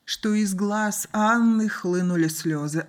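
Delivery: plain reading voice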